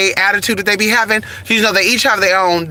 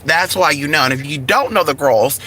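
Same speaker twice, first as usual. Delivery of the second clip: ordinary talk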